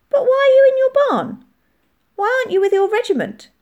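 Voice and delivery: put-on high, loud child's voice; inquisitive child, challenging. A narrator's reading. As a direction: press the soldier with a child's bluntness.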